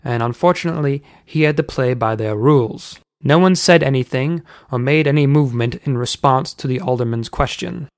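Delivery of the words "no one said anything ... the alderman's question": plain reading voice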